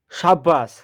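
plain reading voice

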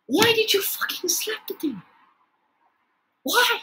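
very high, pleading protest; high-pitched exasperation. Real, not acted. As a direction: wail it in exasperated disbelief. Protesting at the slide slap.

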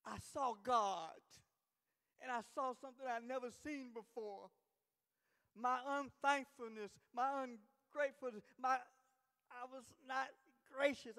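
loud, halting, weighty confession; impassioned preaching. Real, not acted. Preacher confessing his own ungratefulness to the church, impassioned preaching. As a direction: proclaim each failing with full force.

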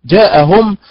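mocking the wrong reading; wrong stress. Shown as a demonstration. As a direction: pop جاءهم up at the end. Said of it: "بدلا من أن كان".

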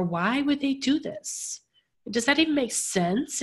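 ordinary delivery

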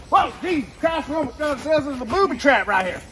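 high, loud startled outburst. Acted. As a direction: jolt and blurt it out. Startled by booby trap.

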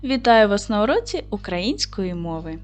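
ordinary talk, nothing marked

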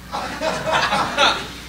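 a high, short stunned laugh; nervous disbelieving laugh. Retold or imitated. Man overhearing militia plan his murder, stunned laugh. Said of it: "and I'm like".

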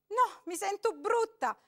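frightened woman refusing; pleading. A narrator's reading. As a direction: beg him to go away.

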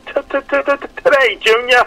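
high, stuttered movie line; Billy Madison stutter. Retold or imitated. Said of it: "the line from Billy Madison".

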